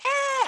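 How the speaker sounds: quick high-pitched mock scream; imitated little scream. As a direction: mimic a tiny startled shriek. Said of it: "I did like his little scream".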